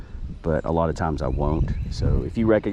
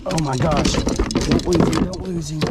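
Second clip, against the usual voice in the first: much higher, urgent pleading; real scramble not to lose the fish; high-pitched panic as the fish thrashes; excited panic; 9 semitones higher than usual. Real, not acted. Fish about to jump free.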